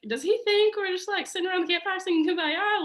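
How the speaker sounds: imitating mom's incredulous scoff; mocking mom. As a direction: ridicule the idea in a squeaky mock voice. Mom mocking dad's notion.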